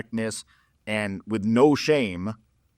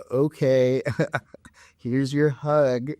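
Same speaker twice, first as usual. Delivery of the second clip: reluctant woman; 3 semitones higher, much slower than usual. Retold or imitated. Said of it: "she's like".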